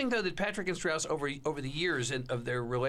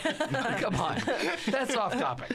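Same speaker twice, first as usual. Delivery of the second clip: laughing; 8 semitones higher, much slower than usual. Real, not acted. Teasing a co-host's plug.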